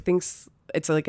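ordinary talk

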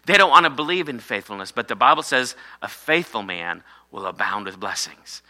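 ordinary talk

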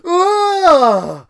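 very high, very loud grossed-out yelp; disgusted ugh. Retold or imitated. Fry hearing about the rump, loud disgusted ugh. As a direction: shriek out your disgust. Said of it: "Fry goes".

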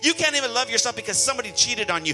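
forceful, driving sermon with hammered repetitions; fervent preaching. Real, not acted. Preacher telling the wounded they may love again, fervent preaching. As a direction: preach it with building urgency.

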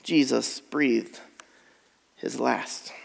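reading the death, fading; slow fading hush. Real, not acted. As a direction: let the words die away.

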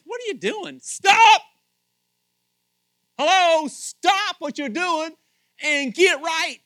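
high, shouted rebuke; Jesus speaking loud. Acted. Jesus confronting sinners who need to get right, shouting. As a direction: shout it with stretched, hammered words. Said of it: "Jesus is starting to speak real loud".

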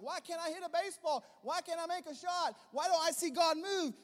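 high, loud mock whine of a frustrated player; high mock frustrated question; mock whiny complaint. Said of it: "and you're like".

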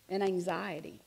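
usual speaking voice

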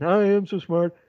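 gleeful cartoon dimwit singsong; Homer Simpson. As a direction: crow it like a proud cartoon oaf. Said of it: "It's like Homer Simpson".

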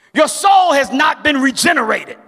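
ordinary talk, nothing marked

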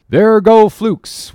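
high, loud drawn-out sighting call; lookout's cry. A narrator's reading. Lookout to the whaleboats at sighting flukes, loud cry. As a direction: cry it out across the water, slow and high. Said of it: "was the cry".

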